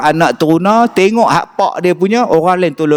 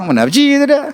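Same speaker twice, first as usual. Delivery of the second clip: mock protest; 11 semitones higher, faster than usual.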